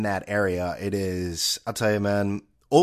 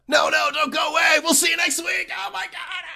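high, shouted announcer sign-off; much higher hammed-up announcer shout; mock wrestling announcer; 3 dB louder than usual. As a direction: hype it up like a TV wrestling announcer closing the show. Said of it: "it's not the same without the announcers".

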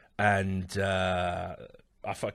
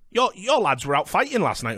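mock scolding; 9 semitones higher, 4 dB louder than usual. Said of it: "I remember getting told by".

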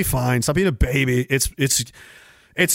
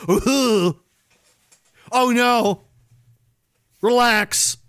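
ordinary talk, nothing marked

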